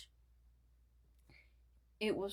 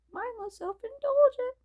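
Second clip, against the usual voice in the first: much higher, suddenly put-on; odd high voice; 7 dB louder than usual. Reader breaks into a squeaky character line.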